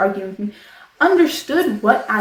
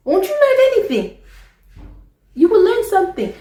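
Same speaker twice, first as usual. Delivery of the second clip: exasperated high challenge; 12 semitones higher, 5 dB louder than usual. Real, not acted. Rhetorical challenge to viewers.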